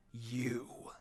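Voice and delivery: low, hissed threat; menacing whisper. Acted. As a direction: whisper it like a deadly warning. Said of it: "Sesshomaru growled furiously".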